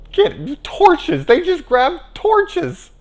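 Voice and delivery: high, loud, slow mocking; incredulous mockery. Real, not acted. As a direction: scoff at the torches, stretched out. Mocking torch absurdity.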